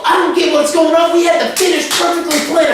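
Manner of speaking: high, heated rant at the promoter. Retold or imitated. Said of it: "I mean, I'm going off".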